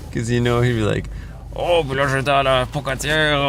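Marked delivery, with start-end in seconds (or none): 1.6-3.4 mimicking a thick French-Canadian accent. Son mimicking mentor Réal's thick French-Canadian accent. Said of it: "He'd speak like that".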